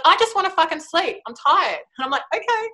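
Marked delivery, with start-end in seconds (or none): 0.1-1.8 mock whine, pitched higher; cranky inner child. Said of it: "And she's like". 2.3-2.7 put-on high, sing-song coaxing like to a toddler; soothing. Soothing tired inner child.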